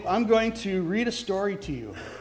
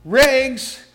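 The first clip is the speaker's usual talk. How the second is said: calling out; 7 semitones higher, 7 dB louder than usual. Acted. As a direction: clear tenor street call, loud and high. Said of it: "calling in a clear tenor voice".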